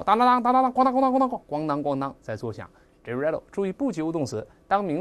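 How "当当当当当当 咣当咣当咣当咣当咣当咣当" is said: high, loud burst of clanging; rapid clattering sound effect; imitated coin rattling. Shown as a demonstration. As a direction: imitate a shaken money box. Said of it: "那拿起来这么一摇".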